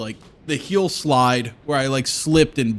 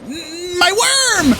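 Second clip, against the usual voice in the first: much higher, hum then a loud squeal of my worm; mock worm-guarding squeal; 5 dB louder, much slower than usual. Refusing the vaccine to protect his worm, high loud squeal. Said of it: "I'll be like".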